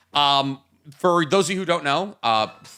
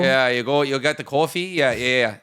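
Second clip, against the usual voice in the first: lightly put-on Minnesota accent; playful Canadian-sounding Minnesota accent; 3 semitones lower than usual. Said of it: "It's like Canadian accent".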